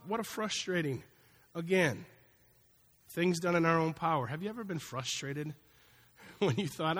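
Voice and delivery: ordinary talk, nothing marked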